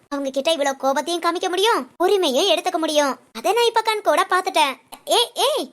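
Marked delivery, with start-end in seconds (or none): 5.2-5.7 flustered hey hey melting into a laugh; embarrassed laugh. Acted. To her friend who teases her about love, laughing it off. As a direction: brush off the teasing with a laugh.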